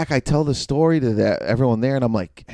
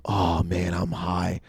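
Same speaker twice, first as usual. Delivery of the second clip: stoned muttering; 4 semitones lower, 9 dB quieter, slower than usual. Retold or imitated. Stoned self, retold inner thought.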